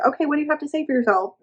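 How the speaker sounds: fast, high mocking taunt; mocking demand. Mother mocking while choking her.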